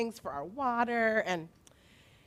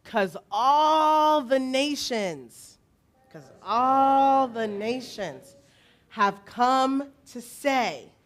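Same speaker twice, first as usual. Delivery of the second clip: slow, loud, stretched-out words; repeat-after-me call; 5 semitones higher, 5 dB louder, slower than usual. Shown as a demonstration. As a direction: call it out slowly for a group to echo. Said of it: "repeat after me sort of thing".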